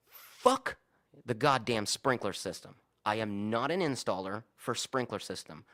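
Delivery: emphatic retold outburst; retold angry yell. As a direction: re-enact blowing up, punching the start and the sign-off. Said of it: "I yelled at him".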